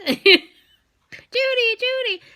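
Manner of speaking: high chirping "Judy" call; high mimicked birdsong; bird call imitation. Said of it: "they're like".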